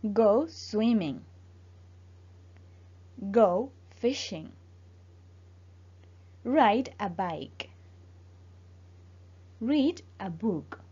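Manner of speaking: slow, careful English model phrases for repeating. Shown as a demonstration. Teacher to Spanish-speaking student, pronunciation drill: slow careful model. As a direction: say each phrase slowly so the student can copy it. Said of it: "Repite después de mí para que mejoremos tu pronunciación".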